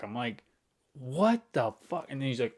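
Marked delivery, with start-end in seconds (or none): 0.9-2.1 slow, stretched disbelief; slow retold exasperation; incredulous. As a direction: drag out the outrage. Retelling own disbelieving reply.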